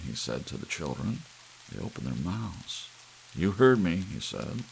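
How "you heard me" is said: louder firm rebuke; stern order. Acted. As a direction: shut them down firmly. Father orders children to bed.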